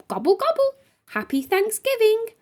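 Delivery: high, beaming turkey voice; grinning turkey. A narrator's reading. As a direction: gobble it out with a big grin. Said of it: "replied Toby with a grin so big".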